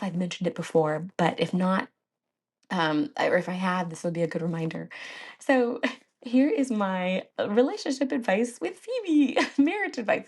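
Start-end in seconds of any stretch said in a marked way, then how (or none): 5.4-10.3 giggling through the words; laughing. Real, not acted. Giggling as she introduces marriage advice to viewers. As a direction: laugh as you announce it, a little higher.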